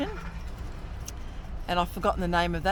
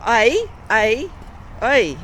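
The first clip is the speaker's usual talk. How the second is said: loud, raised Canadian "eh"; 4 semitones higher, 6 dB louder, much slower than usual. Shown as a demonstration. Showing companion how Canadians end sentences, loud Canadian "eh". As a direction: say each one as a punchy Canadian "eh". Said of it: "there are some words Canadians say".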